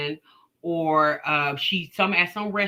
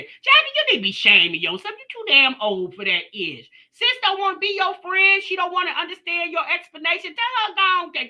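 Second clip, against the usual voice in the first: sassy scolding; 10 semitones higher, 4 dB louder than usual. Real, not acted. Scolding Jasmine on the live.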